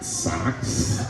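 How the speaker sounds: hushed, low questioning disbelief; baffled echo. Acted. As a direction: repeat it softly, as if you can't believe it. Acts dad baffled by son's plan.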